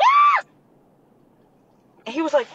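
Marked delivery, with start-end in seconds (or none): 0.0-0.4 very high loud scream; frozen scream. Retold or imitated. Woman reenacting freezing after the touch, loud high scream. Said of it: "I froze, and I was just like".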